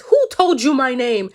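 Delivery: loud, slow, acted outrage; mock-livid, hitting each word hard; livid lady. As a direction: demand it as a furious old lady. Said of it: "And the lady is livid".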